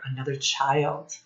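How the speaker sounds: ordinary delivery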